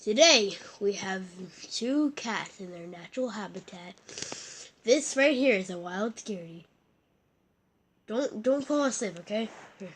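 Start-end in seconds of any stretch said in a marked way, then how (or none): 0.0-6.6 solemn wildlife narrator voice; nature documentary. Acted. Kid narrates cats as documentary.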